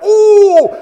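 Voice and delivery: very high, loud yelped "Ooh!"; pained yelp. Retold or imitated. Boy yelps as the wax strip rips off, high yelp. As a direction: squeal it out like a sudden sting. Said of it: "he's like".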